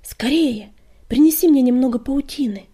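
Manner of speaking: urgent loud order. Acted. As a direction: hurry her along. Healer urgently orders help.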